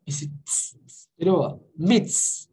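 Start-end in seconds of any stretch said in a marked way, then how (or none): 0.4-1.1 voiceless "tss" hiss; isolated TS sound. Shown as a demonstration. Teacher isolating the ending for students, voiceless ts. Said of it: "como se fosse só um T S". 1.8-2.5 "Myths" as "mits", ending in ts. Shown as a demonstration. Teacher confirming the plural for students, ts demo. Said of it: "como se fosse só um T S".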